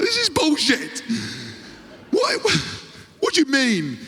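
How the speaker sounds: high, slow, disbelieving protest; mock outrage. Teasing teammates, player protests in high disbelief. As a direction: squeak out the protest. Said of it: "I was like".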